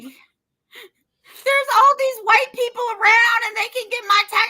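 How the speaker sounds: high-pitched mock sobbing; imitating crying. Daughter complaining to her mother on the phone, mock crying. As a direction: wail it like an upset daughter, exaggerated. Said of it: "And she's crying, right?".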